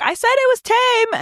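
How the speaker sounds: high, mocking imitation of the owner; mocked Karen. Karen walking away with her bird, high mocking protest. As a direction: pitch it way up to mock her. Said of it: "She's like".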